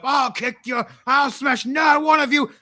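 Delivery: high, loud tough-guy boast; brawler bravado. Acted. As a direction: puff up like a drunk bar brawler. Bar brawlers boasting before fight.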